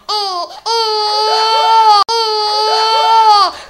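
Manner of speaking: put-on loud, high baby wail; imitated baby cry. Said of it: "I can cry like a baby".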